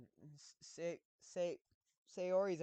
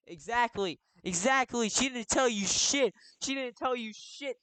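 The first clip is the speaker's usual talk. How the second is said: loud, indignant shouting; vehement agreement; 7 semitones higher, 12 dB louder than usual. Real, not acted. Streamer insisting she told him nothing, indignant shout. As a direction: yell it as proof you are right.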